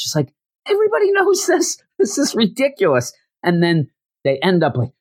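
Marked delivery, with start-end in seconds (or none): 0.7-3.1 very high, squeaky outburst; comic high-pitched disbelief; mock exasperation. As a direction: protest in a strained high voice, hammed-up. Exasperated character acted in retelling.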